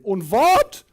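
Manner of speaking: put-on loud, high-pitched indignant German accent; outraged German teacher act; offended German. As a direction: bellow it in a mock German accent, greatly offended. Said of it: "pretending to be greatly offended".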